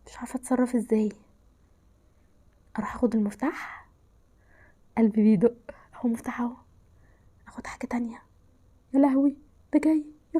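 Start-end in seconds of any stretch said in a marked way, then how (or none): 0.1-8.2 hushed, breathless whisper while hiding. Real, not acted. Gamer hiding from the clown, whispering to her viewers. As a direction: keep it to a whisper so he won't hear.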